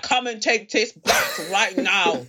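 slow imitated Polish accent, demanding. Partner in Polish accent demanding the order go back. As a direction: mimic a Polish man sending food back. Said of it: "in a Polish accent".

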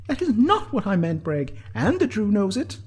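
high, loud, heated retort; hotly countering. A narrator's reading. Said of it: "countered the Ard hotly".

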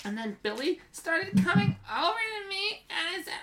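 put-on high, girlish kid-sister voice reading her diary; bratty kid sister reading her diary aloud; sister's voice. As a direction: read it as your little sister, whiny and dramatic. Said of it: "Should I do it in my, my sister's voice?".

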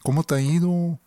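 Juárez accent. Shown as a demonstration. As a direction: show off a northern Mexican Juárez lilt. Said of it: "Un poco así".

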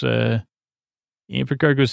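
normal talking voice

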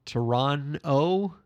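"Toronto" drawn out very slowly; careful pronunciation. Shown as a demonstration. Guest showing the host how to say the city's name, careful pronunciation. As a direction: stretch out each syllable of "Toronto". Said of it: "How do you say the city's name again?".

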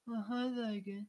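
high, breathy-free simpering mock of a helpless Jean; mock the weak damsel, pitched well up and swooning. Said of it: "It's like".

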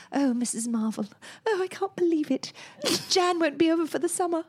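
mock soap drama. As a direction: play yourself in a sad film, overacted. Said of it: "touting lines like".

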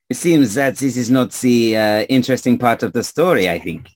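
put-on offhand remark in a French accent. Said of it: "stranger with a French accent".

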